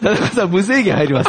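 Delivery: blaring fast arcade announcer, high and loud; pachinko parlor announcer. Pretend. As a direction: hype it like a pachinko hall PA. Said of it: "パチンコ屋のアナウンスみたいな". (パチンコ屋のアナウンス風)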